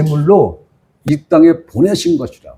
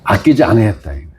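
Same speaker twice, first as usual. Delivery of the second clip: normal talking voice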